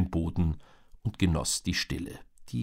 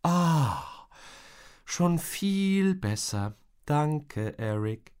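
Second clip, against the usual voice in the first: higher, slow relieved sigh of words; relieved mom; 7 semitones higher, much slower than usual. A narrator's reading. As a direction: Mom freed from the knot. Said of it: "erleichtertes Seufzen". (erleichterte Mutter)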